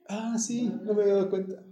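put-on high, surprised little kid; a child answering dad; sheepish child. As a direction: play the kid who just realised. Child answering parent in example.

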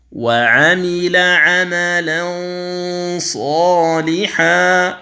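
ينظرون drawn out with the nasal hum held; slow tajweed recitation. Shown as a demonstration. Teacher demonstrating ikhfa before ظ, slow recitation. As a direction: chant ينظرون slowly through the nose. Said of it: "التنوين عند الصاد".

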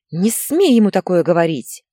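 loud, outraged mother; near-hysterical anger. A narrator's reading. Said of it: "У Инны началась истерика". (мать на грани истерики)

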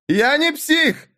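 high, strained yell of protest; shouting. A narrator's reading. Beaten youth yells at the librarian, high furious shout. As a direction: scream it so ears ring. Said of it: "заорал парень так, что в ушах зазвенело".

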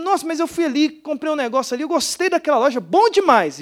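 high-pitched imitation of excited chatter; gushing mineira woman. Pastor mimicking the churchgoer's praise of a shop, gushing. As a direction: rave about a shop, mock-enthusiastic. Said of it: "Aí eu falei assim".

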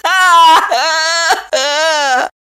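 high, drawn-out bawling; woeful squealing cry; wailing sobs. A narrator's reading. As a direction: trail the wail into high sobs. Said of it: "горестно провыла".

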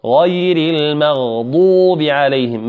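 غير المغضوب drawn out with heavy غ and ض; careful teaching recitation; slow tajweed demo. Shown as a demonstration. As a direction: slowly model غير المغضوب for the class. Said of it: "الغاء مفخمة والضاد مفخمة".

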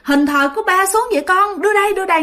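high-pitched urgent questioning; fretting grandma demanding an explanation; alarmed grandmother. Acted. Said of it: "bà nội nghe cũng chạy lên hỏi".

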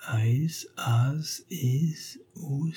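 soft, halting sounding-out, rising in pitch; ânonne. A narrator's reading. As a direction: try each syllable under your breath. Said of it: "il l'annonna de nouveau". (ânonnement hésitant, à mi-voix)

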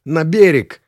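high, loud urgent cry; croaked cry. A narrator's reading. Passenger urging the pilot back to shore, croaked. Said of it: "прохрипел он".

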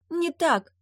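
sharp high cry of denial; shouted. A narrator's reading. Nepryadva shouting back at the sorcerer, high cry. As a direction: fling it out as a shout. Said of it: "выкрикнула Непрядва".

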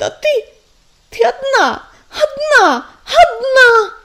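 high, loud angry crying; rising tearful rage. A narrator's reading. Defiant outburst after shouting.

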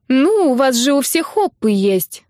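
whiny little sister, slow and high; whining. Acted. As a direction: whine it out like a kid sister. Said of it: "заныла сестра".

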